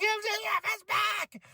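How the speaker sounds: very high, shrieked retelling of the scream; high-pitched scream. Retold or imitated. Fan reliving his scream at MJF's return, shrieking high. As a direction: shriek it like you jumped out of your seat. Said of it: "I screamed the highest".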